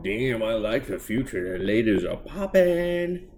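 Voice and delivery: high, slow mock-horny drawl; leering dude. Host mocks Speed Lad gawking at ladies, hammed-up lecherous drawl. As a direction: ham up a lecherous bro voice. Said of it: "He was just like".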